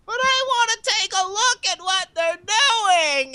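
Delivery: put-on high whiny voice, a kid begging to peek; whiny ISP. The nosy ISP begging to look over the wall, high whine. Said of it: "your I S P's like".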